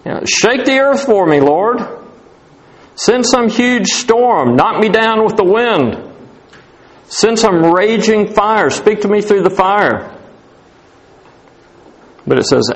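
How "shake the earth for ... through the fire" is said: mock demanding prayer. A believer begging God for a dramatic sign, mock pleading. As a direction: pray big and dramatic, demanding a show. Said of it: "isn't that how we want him to speak to us".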